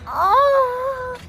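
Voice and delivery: long, very high held "ah"; mock sung wail. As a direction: let out a hammed-up high wail on one note. Climax of parody song.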